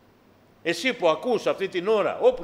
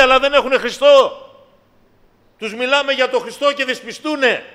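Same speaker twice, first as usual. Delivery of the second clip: δυνατό, ψηλό, φλογερό κήρυγμα; φωνάζει το μήνυμα στο εκκλησίασμα; κήρυγμα με πάθος; 9 semitones higher, 10 dB louder than usual. Acted. Preaching at full force. (κηρύττει δυνατά με πάθος)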